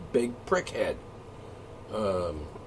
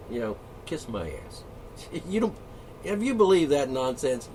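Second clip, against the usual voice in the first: ordinary delivery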